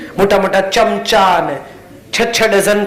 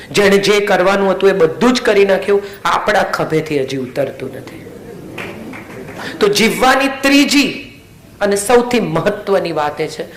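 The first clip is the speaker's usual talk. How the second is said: usual speaking voice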